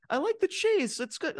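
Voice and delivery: high-pitched, badly faked commentator voice; Kevin Patrick. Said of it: "I can't do his accent".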